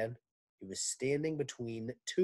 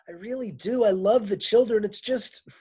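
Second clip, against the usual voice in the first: tearful; 9 semitones higher, 9 dB louder than usual. Acted. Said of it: "wiped her eyes".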